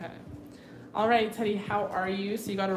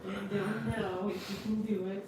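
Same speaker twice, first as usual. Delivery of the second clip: cooing pet talk; 2 semitones higher, 4 dB quieter than usual. Real, not acted. Coaxing the dog.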